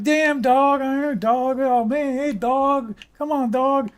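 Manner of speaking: high, loud pleading street voice; hammed-up arrested man begging the officer; arrested guy on Cops. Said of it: "He's like".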